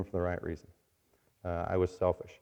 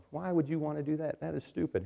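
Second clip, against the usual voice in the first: high, mildly scornful objection; dismissive challenger; 6 semitones higher, 5 dB quieter than usual. Acted. Somebody challenging a new believer, mildly dismissive. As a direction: question it in disbelief, pitched up.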